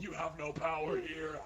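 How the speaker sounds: possessed woman taunting the man about to burn her; demonic taunt. Acted. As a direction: hiss it like a demon.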